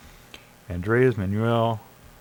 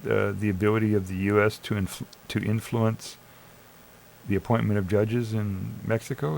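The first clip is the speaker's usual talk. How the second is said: normal talking voice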